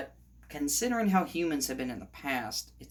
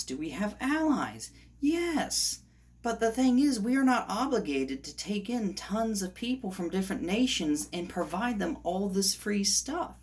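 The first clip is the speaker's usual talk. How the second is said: raised, insistent, exasperated argument; worked up; 6 semitones higher than usual. Real, not acted. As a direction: argue it hotly with a higher voice. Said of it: "it gets to me".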